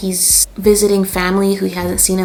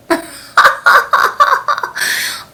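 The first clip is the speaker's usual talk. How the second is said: high burst of laughter; 5 dB louder than usual. Real, not acted. Laughing at being taken for younger.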